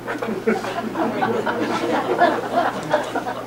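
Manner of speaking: quiet breathy chuckle; soft laugh. Real, not acted. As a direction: laugh softly to yourself. Laughs at the poem's ending.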